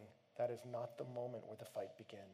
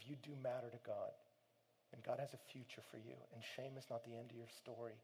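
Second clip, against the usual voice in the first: gentle; 2 semitones lower, 9 dB quieter than usual. Retold or imitated. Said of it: "they were so gentle here".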